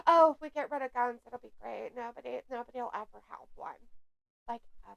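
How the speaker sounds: low, hushed sarcastic imitation; mock naivety. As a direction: toss it off softly as a dim, trusting believer. Mocking naive gun-control argument.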